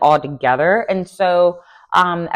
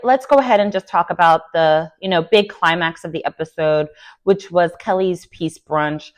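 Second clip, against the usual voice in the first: normal talking voice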